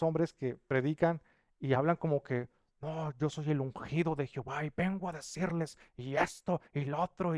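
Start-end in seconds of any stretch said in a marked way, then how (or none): 2.8-7.4 a hint of a haughty preacher, softer; mock the self-anointed preacher lightly; arrogant preacher. Pastor mocks proud preachers, a touch of haughty mimicry. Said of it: "hablar con una prepotencia".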